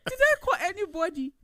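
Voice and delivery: very high-pitched, hammed-up protest; mock protesting denial. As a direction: squeal innocence as if backing down. Retold self, backing down in denial.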